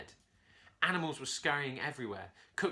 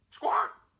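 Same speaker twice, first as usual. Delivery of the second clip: very high, loud squawk; parrot squawk; 11 dB louder than usual. A narrator's reading. Parrot squawks as it flies away. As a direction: screech like a parrot. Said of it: "the parrot cackled".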